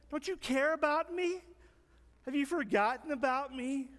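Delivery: high-pitched, self-pitying whine; whining. Acted. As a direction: whine as if you've been forgotten. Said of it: "whine and complain".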